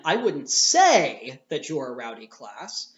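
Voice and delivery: "say" stretched and pushed up in pitch; slow line, hitting "say" hard to hint otherwise; stress on "say". Shown as a demonstration. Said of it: "We put the accent on the word say".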